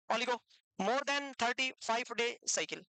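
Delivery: measured, word-by-word dictation; slow dictation. Real, not acted. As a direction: dictate slowly for note-takers. Teacher dictates for students to write. (लिखवाने के लिए धीरे-धीरे बोलना)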